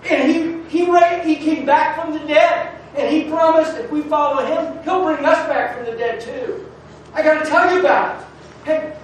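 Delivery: loud, high, impassioned sermon; full-force preaching. Real, not acted.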